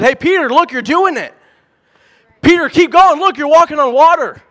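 high, loud shouts of encouragement from the boat; excited, rising cheers calling Peter to keep going; excited cheering. Retold or imitated. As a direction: cheer him on like a crowd. Imagined disciples cheering Peter on.

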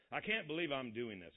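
ordinary delivery, nothing marked

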